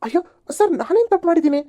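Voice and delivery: high, whining cry of protest; desperate plea. Acted. Man begs the policeman not to take him to the station. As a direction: beg not to be taken in.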